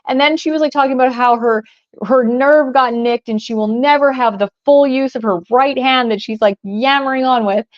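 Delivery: ordinary delivery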